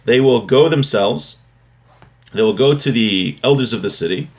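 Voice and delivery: usual speaking voice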